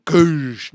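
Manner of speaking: loud mock impact whoosh; imitated crash noise. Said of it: "And you go".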